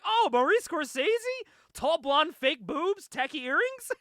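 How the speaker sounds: high, loud mock-excited girl; excited recognition. Retelling Panda's reaction to the name, mock excited. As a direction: blurt it out high and loud in imitation.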